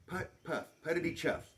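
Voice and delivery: ordinary delivery, nothing marked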